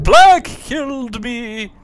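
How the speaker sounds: high, slow ghostly wail; overacted death scene. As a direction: wail the accusation slowly. Ghost accusing the killer.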